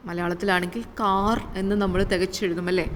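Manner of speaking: usual speaking voice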